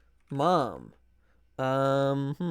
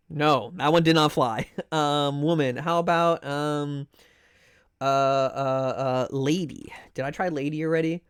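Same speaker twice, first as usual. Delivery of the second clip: ordinary delivery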